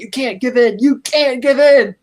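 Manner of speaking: Harvey, angry, pleads with Batman not to give in, rising on the repeat; mock angry plea. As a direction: hammer it home, building.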